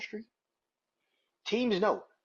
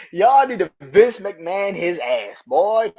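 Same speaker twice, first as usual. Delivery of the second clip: louder, drawn-out cry of exasperation; emphatic exhortation; 5 semitones higher, 10 dB louder, slower than usual. Real, not acted. Fan urging others to force the owner out, emphatic holler. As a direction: holler it slowly, hammering the demand.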